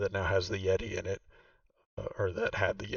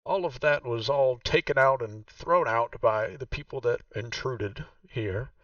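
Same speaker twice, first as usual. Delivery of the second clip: game master voicing Vaku, noticeably higher; Vaku's voice; 3 semitones higher, 4 dB louder than usual. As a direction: play the proud host showing her old home. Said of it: "and she says".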